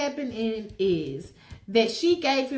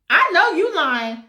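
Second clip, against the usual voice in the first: high, loud, laughing scold; sassy woman scolding, laughing; 10 semitones higher, 7 dB louder than usual. Acted. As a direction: tell him off with a laugh. She scolds him, laughing.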